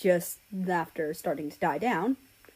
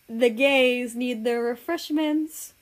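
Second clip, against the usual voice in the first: campy drawn-out quip; 5 semitones higher, 4 dB louder, slower than usual. Acted.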